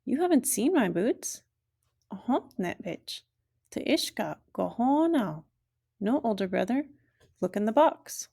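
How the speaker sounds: animated story reading, voices high and lively; high, lively storybook reading; story read to children. Acted. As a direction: read the bilingual story to learners, playful. Reads a story with characters.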